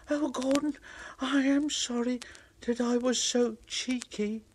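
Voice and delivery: apologetic little engine. Acted. Thomas meekly apologising to Gordon. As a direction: say sorry meekly.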